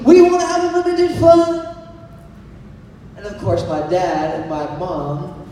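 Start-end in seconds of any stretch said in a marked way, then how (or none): 0.1-1.7 put-on high, loud kid's yell; retold scream. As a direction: belt it like an eager little brother. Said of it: "my brother just screams".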